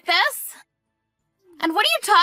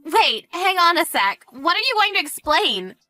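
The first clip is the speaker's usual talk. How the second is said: high, alarmed interruption; 6 semitones higher, 3 dB louder than usual. Acted. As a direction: cut in, suddenly worried. Alarmed at threat to tell husband.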